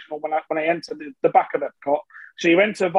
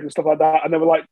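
usual speaking voice

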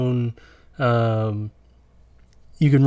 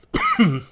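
chesty cough; 10 semitones higher than usual. Real, not acted. Coughing fit during list.

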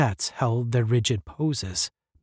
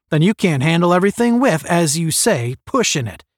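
fast, loud, raised retort; snapping; 6 semitones higher, 6 dB louder than usual. A narrator's reading. Captain cuts down a boastful cadet, snapping. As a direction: snap the cadet's own phrase back. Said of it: "snapped Strong".

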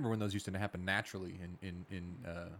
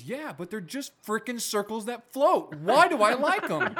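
much higher, louder and slow, mock-exasperated kid; puzzled little boy; 12 semitones higher, 9 dB louder, much slower than usual. Dad imitating his son's bubble crisis, high, slow and loud. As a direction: whine it like a boy baffled by bubbles. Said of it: "He goes".

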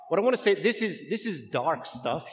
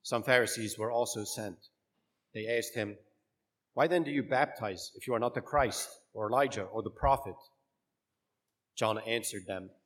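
measured liturgical proclamation; solemn church reading voice; gospel reading; 4 semitones lower, 5 dB quieter than usual. Acted.

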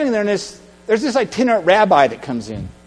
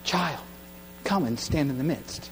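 much softer, tender repeat; gentle rabbi call; 3 semitones lower, 12 dB quieter than usual. Acted. Rabbi softly repeats his call to the nervous man, hushed. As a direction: coax him softly, almost hushed. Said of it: "He's kind of nervous".

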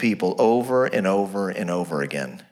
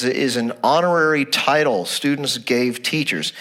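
ordinary delivery

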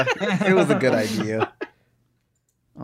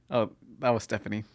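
ordinary talk, nothing marked

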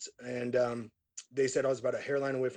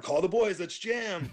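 retold excitement; 6 semitones higher, slower than usual. Singer to drummer after voice returns, eager. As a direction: fire up the band with fresh energy. Gets his voice back, excited.

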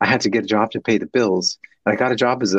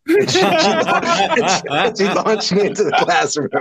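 laughing; 7 semitones higher, slower than usual. Real, not acted. Laughing while recalling being launched.

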